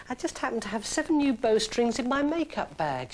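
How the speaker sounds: a touch of mock innocence; playfully. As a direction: play innocent, coy. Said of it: "she said playfully".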